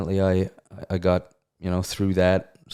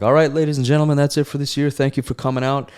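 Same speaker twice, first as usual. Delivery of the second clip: loud, higher emcee voice closing the night; host's announcement; 7 semitones higher, 5 dB louder than usual. Retold or imitated. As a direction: boom it out like an event host. Event host announcing the end.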